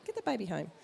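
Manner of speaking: a light tut at a new mum; mock exasperated shock. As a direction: midwife scolding a mum with a newborn in the supermarket. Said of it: "and you go".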